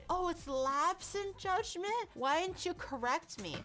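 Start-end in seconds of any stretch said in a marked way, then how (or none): 0.1-1.9 high, slowed dawning oh; realizing she said the wrong word; surprised realization. Real, not acted. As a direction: catch the mistake with surprise. Realizing her mistake.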